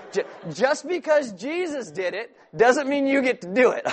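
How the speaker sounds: normal talking voice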